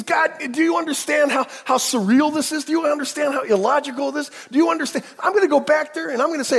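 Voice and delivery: high, loud exasperated outburst; exasperated shout. Acted. Moses shouting at God in disbelief.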